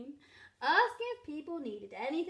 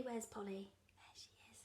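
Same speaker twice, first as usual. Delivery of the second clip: whispering; 3 semitones lower, 13 dB quieter than usual. Real, not acted. Reader aside to child.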